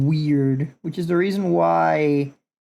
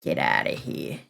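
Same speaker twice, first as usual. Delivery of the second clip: whispered dismissal; 14 semitones lower, 6 dB quieter than usual. Acted. Dismissing the mistake.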